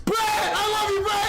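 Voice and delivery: high, excited shout of adoration; fangirl shriek. Pretend. Viewer cheering on the speaker they adore, high excited shout. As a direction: squeal it out like a smitten fan.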